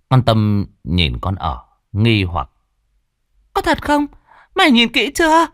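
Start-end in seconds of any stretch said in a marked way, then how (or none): 3.5-5.5 high, shaken and doubtful; frightened mistress questioning her maid; suspicious mistress. Acted. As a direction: demand if she truly looked. Mistress voiced.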